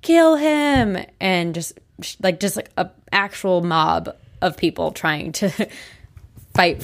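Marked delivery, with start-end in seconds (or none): 0.0-1.0 high, drawn-out imitation of a yell; stretched-out mock crowd yell. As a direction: imitate an angry mob's cry without full volume. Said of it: "they were yelling things like".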